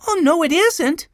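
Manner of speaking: put-on high, loud child's protest; high little-boy voice; little boy Benny. A narrator's reading. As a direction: contradict like a small boy, pitched right up. Little Benny contradicting Joe.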